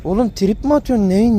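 normal talking voice

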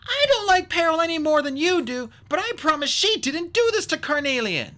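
high, forceful character voice; Tsunami's insistence. A narrator's reading. Tsunami defends Peril to the Skywings, forceful insistence.

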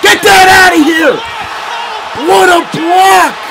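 real hype shout; triumphant shout. Real, not acted. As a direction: roar it after the big block. Yells after a block.

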